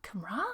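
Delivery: Camera echoed as kuh-MRAH with a questioning rise; puzzled echo. Acted. Listener repeating the odd word back, puzzled kuh-MRAH. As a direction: softly query Camera, stressing the final RAH. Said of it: "And you'll be like".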